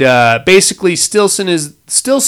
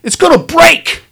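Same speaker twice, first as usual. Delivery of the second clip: frantic, much higher cry of alarm; mock-panicked shriek, pitched way up; panicked warning; 12 semitones higher, 3 dB louder, slower than usual. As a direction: yelp the warning like the ice is already cracking. [name] frantically warning about the ice.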